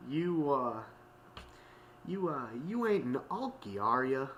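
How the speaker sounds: put-on hesitant question in a country accent; accidental country accent. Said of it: "that sounded like a country accent".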